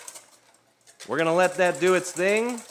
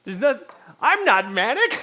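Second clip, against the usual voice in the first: high, loud laughing protest; laughing denial; 10 dB louder than usual. Real, not acted. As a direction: squeal it through a real laugh. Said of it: "That's how it sounds".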